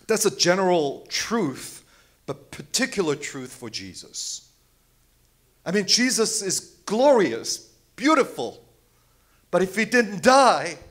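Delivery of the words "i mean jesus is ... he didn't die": much higher, louder, passionate praise; fervent exclaiming. Real, not acted. Preacher praising Jesus to congregation, fervent. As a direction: lift them up with passion.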